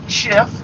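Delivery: Schiff said with a clean final f and no t; correct pronunciation. Shown as a demonstration. Correcting listeners who say Shift, Schiff without the t. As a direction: say Schiff, ending on the f with no t. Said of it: "not Shift, there's no T in it".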